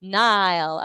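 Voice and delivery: Nile drawn out with a long "eye" vowel; corrected pronunciation. Shown as a demonstration. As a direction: say Nile loudly, like the river. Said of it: "I gave you the [name]".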